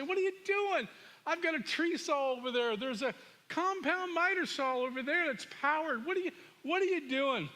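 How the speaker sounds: high-pitched exasperated father, retold; angry and frustrated. Father sees son sawing tree with drywall saw, exasperated. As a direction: act out a frustrated dad's rising disbelief. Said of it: "I'm angry and frustrated".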